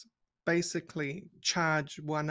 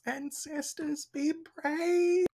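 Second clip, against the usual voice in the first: shouted battle cry; 12 semitones higher, slower than usual. Acted. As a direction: call out to rally your spirit warriors, drawn out and rising. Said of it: "Aelia shouts".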